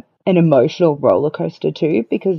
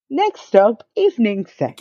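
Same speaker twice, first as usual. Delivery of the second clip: high announcer call, breaking into laughter; train announcer voice; 8 semitones higher, slower than usual. Retold or imitated. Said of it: "The train announcer voice".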